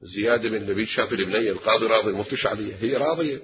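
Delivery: ordinary talk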